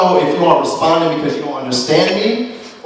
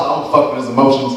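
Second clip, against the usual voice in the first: normal talking voice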